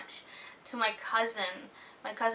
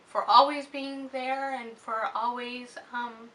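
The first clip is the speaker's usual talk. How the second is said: choked up; 3 semitones higher, 4 dB louder than usual. Real, not acted. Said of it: "I was getting way too emotional".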